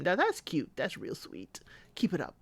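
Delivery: hushed aside whisper; soft whispered approval. Pretend. Mock cooing at the couple.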